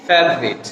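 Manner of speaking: ফেভারিট squeezed to "fev-rit"; correct pronunciation. Shown as a demonstration. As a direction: drop the middle vowel of ফেভারিট, fev-rit. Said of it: "উচ্চারণটা হচ্ছে কি". (শুদ্ধ উচ্চারণে ফেভারিট, "ফেভ-রিট")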